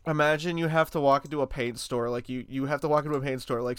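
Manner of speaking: normal talking voice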